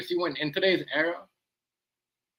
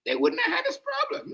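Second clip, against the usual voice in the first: way higher, a crying shout; mock wailing shout. Repeating it to viewers as a mock crying wail. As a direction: howl it like a mock lament.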